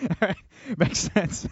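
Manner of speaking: high-pitched, words broken up by giggles; laughing. Real, not acted. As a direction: talk through real laughter at a friend's bit. Cracks up at guest's Batman bit.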